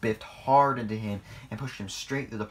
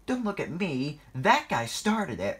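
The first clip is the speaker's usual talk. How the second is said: higher-pitched, defensive protest; 7 semitones higher, 4 dB louder than usual. A narrator's reading. Thomas blaming Diesel before the boss, protesting. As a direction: push the blame away indignantly. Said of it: "protested Thomas".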